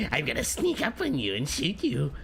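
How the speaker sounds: sneaky little gremlin voice; lightly voiced gremlin sneaking. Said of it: "stop with the gremlin voice".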